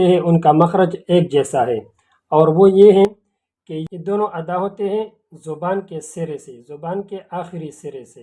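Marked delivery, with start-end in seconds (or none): 3.7-8.2 much softer, careful teacher voice; quietly walk through where the tongue goes; soft explaining. Shown as a demonstration. Teacher softly showing the class where the sounds form. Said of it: "explaining tongue position softly".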